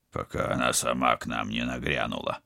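low, soft grumble; grumbling. Acted. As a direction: grumble it under your breath. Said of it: "проворчал Вадим".

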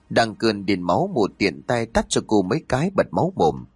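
ordinary delivery, nothing marked

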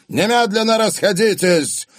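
high, forceful command to disperse; loud shouted order. A narrator's reading. Father sends the partygoers away, shouting. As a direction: shout to clear the room. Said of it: "вдруг громко крикнул он".

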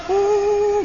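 high, drawn-out mock sob; fake crying. Tutor pretending to cry before the students, mock sob. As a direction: put on a high-pitched fake wail. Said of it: "tutornya itu pura-pura nangis".

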